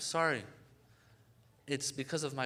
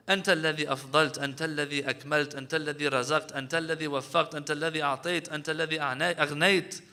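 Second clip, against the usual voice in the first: quick, rhythmic, louder Arabic supplication; rapid dua recitation; 6 dB louder, much faster than usual. Acted. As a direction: roll through the praises fast and fervent.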